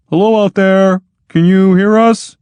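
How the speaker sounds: high, loud call into the dark. Acted. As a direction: call out to someone outside. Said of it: "and called".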